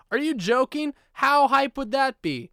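high, loud excitement; hyped. Real, not acted. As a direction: sell the idea with full hype. Excited about the movie idea.

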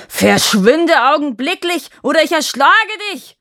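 high, loud furious giant shout; raging giant. Acted. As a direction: scream the threat in a rage. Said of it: "tobte der Riese".

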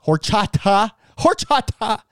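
high, punchy cries of the name; mock exclamation. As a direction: shoot the pitch way up on each shout. Hams up his own silly name.

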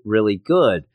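normal talking voice

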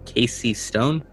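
normal talking voice

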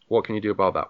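ordinary talk

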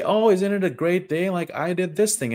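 bright upbeat imitation of a happy friend; chirpy friend. Imitating a happy friend asking how you are, chirpy. Cheerful friend voiced in story.

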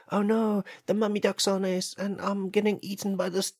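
high-pitched acted panic; mock terror. Human being eaten by the mummy duck, mock panic. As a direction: wail it as a hammed-up victim.

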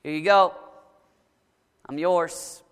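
ordinary talk, nothing marked

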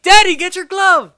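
put-on very high, loud girlish voice; mock little girl. Quoting Kit calling to her dad, high girlish squeal. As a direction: squeal it like a kid. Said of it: "when she's like".

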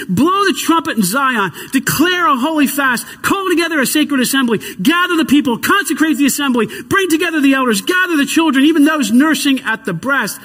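pastor proclaims Joel's call to the people, building; proclaiming. Acted.

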